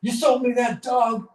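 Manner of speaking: furious bartender. Retold or imitated. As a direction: yell at the man who cheated you. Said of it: "walks right over to this guy and says".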